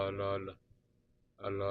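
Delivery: ordinary talk, nothing marked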